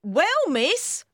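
high, loud housekeeper voice; scolding housekeeper. A narrator's reading. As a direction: pipe up to correct the young miss. Housekeeper rebukes the girl.

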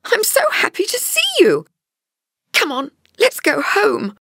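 put-on very high, delighted girl's voice; high-pitched joyful greeting; happy cry. A narrator's reading. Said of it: "cried Linda happily".

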